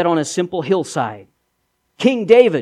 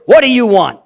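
louder gruff demand at the door; a boy playing a gruff innkeeper, a bit louder; 3 semitones higher, 6 dB louder than usual. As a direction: bark it at the travelers like a grumpy innkeeper. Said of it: "asked them gruffly".

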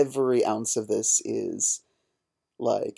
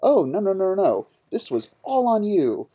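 high mocking whine of denial; 9 semitones higher, 3 dB louder than usual. Podcaster mocking the teacher shifting blame, high whiny denial. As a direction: parody a blame-shifting coward. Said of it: "and be like".

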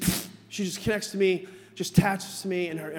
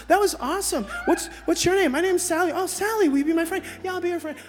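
high-pitched, animated kiddie chatter; excited little girls; 8 semitones higher, 4 dB louder than usual. Retold or imitated. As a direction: imitate delighted children making friends. Acts two little girls befriending.

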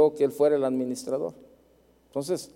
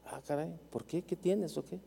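the pastor asks gently and much softer; a hushed, concerned question; quiet pastor; 12 dB quieter than usual. Retold or imitated. Pastor asks why he worries.

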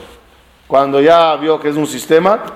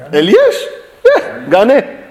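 laughing gloat; 5 semitones higher, slower than usual. Pretend. Mock winner in a debate.

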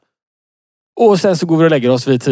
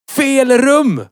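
high, sharp shout from another room; shouted call; 11 semitones higher than usual. Retold or imitated. Girlfriend shouting from the bedroom at sleepwalking boyfriend, high call. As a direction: yell it across the flat to stop him. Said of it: "Jenny ropar från sovrummet".

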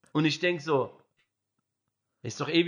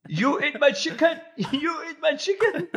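high, loud hammed-up rage with a laugh; mock furious yell; 10 semitones higher, 4 dB louder than usual. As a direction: overdo the knife-waving roommate's fury. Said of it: "und macht so".

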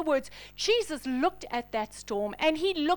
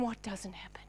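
whispered emphasis; 8 semitones lower, 14 dB quieter than usual. Real, not acted. Preacher drops to a hush.

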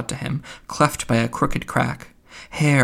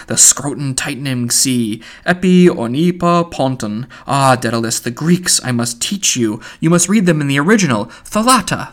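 rhapsodic Buck Mulligan; 4 dB louder than usual. Acted. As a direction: proclaim the sea like a poet. Mulligan rhapsodizing about the sea.

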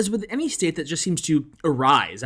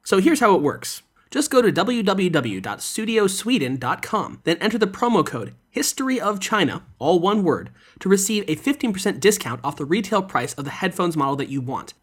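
infomercial; 4 dB louder than usual. Acted. Sponsor ad read.

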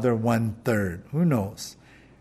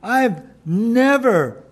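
accusatory; 5 semitones higher, 4 dB louder than usual. Acted. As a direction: drag it out, pointing at the brother. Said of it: "accusatory kind of tone".

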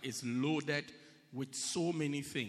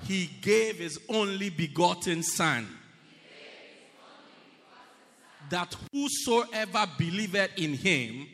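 mean it as you say it; 7 dB louder than usual. Shown as a demonstration. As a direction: proclaim each phrase with weight. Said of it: "And mean it as you say it".

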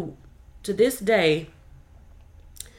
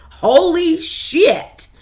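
shocked exclamation; 9 semitones higher, 8 dB louder, much slower than usual. Real, not acted. Outraged exclamation.

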